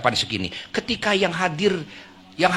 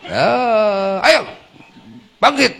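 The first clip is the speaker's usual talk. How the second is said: mock impatient raised call; a touch louder pushy command; impatient rough waking; 5 semitones higher, 5 dB louder, slower than usual. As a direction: hurry her up gruffly. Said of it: "ada suami bilang ke Mamah Nunung".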